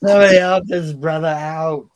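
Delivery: mock plea in a put-on voice; Owen Hart impression. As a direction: belt it out like Owen begging the crowd. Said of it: "Owen Hart stands up and goes".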